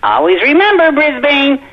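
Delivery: cartoon vegetable voice. VeggieTales sign-off character voice.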